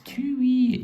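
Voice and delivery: high squeaky cartoon voice, far above his own; Whiskey and Brandy Bollins voice. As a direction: pitch it way up like a cartoon brother. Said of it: "they talked a bit like that".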